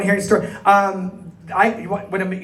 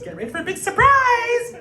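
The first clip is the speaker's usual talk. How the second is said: very high, loud robotic squeal; Total Recall lady voice; 6 dB louder than usual. Retold or imitated. Telling a post office story, the two weeks lady in a very high loud squeal. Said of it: "and then she throws it, goes".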